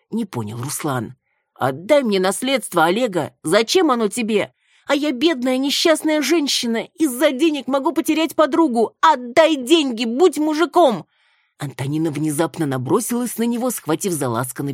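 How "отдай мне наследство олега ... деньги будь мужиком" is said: high, loud wailing demand; drunken yelling. A narrator's reading. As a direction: yell and plead like a drunk. Drunk woman grabs man demanding.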